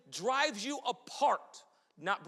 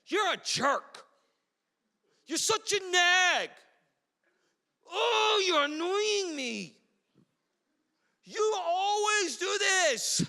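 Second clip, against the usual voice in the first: mock quarrel; 8 semitones higher, 4 dB louder, slower than usual. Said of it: "what do you say?".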